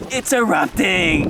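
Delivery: loud, high, drawn-out yell of excitement; excited shout. Real, not acted. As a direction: holler it out slowly, thrilled. Thrilled at the erupting volcano.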